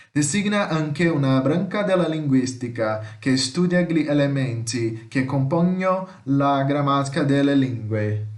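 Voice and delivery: steady, slightly slowed Italian read-aloud; measured Italian reading. Shown as a demonstration. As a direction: read at a medium pace for learners. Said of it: "eu vou ler com vocês aqui em italiano". (leitura em italiano em ritmo moderado)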